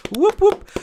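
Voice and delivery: high, loud cheering whoops; celebratory whoop. Real, not acted. Host cheering a channel passing a thousand subscribers, celebratory whoops.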